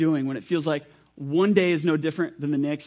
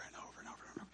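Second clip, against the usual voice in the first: whispered repetition; 25 dB quieter, much faster than usual. Acted. Repeating endless days, voice dropping.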